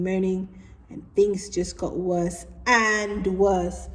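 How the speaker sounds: usual speaking voice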